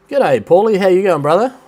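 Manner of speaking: high, bright hello to a mate; cheery greeting. Real, not acted. Greeting mate Paulie joining the livestream, cheery and high. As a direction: hail a friend joining the stream.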